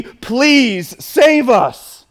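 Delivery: slow, drawn-out pleading; begging plea. Shown as a demonstration. Pastor voicing the meaning of Hosanna, slowly begging. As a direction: beg them to save you, stretching every word. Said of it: "a good translation would be".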